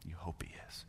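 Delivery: hushed whispered echo. Acted. Pastor to congregation, whispered repeat. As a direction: drop to a whisper. Softened repetition.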